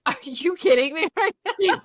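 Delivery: high-pitched mock exasperation; incredulous retort. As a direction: act out disbelief, pitch way up. Retold outburst at partner's question.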